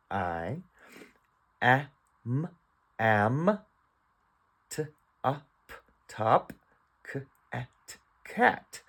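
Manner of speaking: slow beginner-reader decoding; phonics sounding out. Shown as a demonstration. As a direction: separate sounds blended into "am", "top", "cat". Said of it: "remember, this letter is a word".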